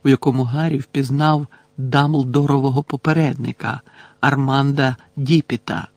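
usual speaking voice

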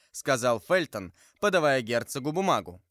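usual speaking voice

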